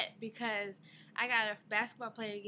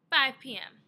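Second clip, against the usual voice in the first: loud; 4 semitones higher, 10 dB louder than usual. Real, not acted. Realizing bank closes now.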